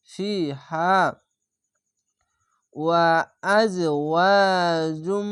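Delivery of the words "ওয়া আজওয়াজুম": slow nasal-held আজওয়াজুম with ghunna; Quran recitation. Shown as a demonstration. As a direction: chant আজওয়াজুম slowly with a humming nasal. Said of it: "ইদগামে বা গুন্না".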